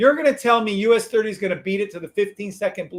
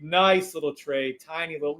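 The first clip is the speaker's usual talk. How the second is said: ordinary talk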